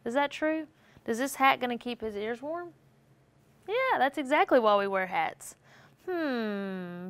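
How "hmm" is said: drawn-out falling purr of a hum; a cat's hum, long and sliding down. A narrator's reading. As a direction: hum it slowly like a contented cat. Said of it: "purred the cat".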